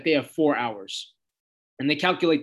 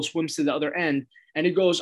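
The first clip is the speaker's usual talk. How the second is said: normal talking voice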